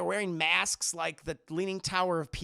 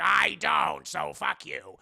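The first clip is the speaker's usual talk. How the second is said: Danny DeVito's Penguin; 4 semitones lower, 5 dB louder, slower than usual. Retold or imitated. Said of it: "Danny DeVito's penguin's like".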